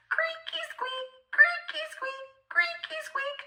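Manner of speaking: very high, soft whispered squeak; creaky whisper. A narrator's reading. Narrator making the house creak: high whispered squeak. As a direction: squeak it like an old creaking door.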